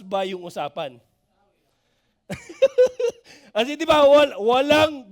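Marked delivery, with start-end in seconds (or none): 2.3-3.1 high laugh. Real, not acted. Laughing at his own question.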